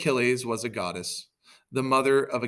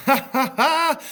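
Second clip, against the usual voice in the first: loud, high ha-ha-ha; hammed-up gloating laugh; triumphant laugh; 9 dB louder, slower than usual. Hector laughs at the absent Achilles, high gloating laugh. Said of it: "Hector was laughing".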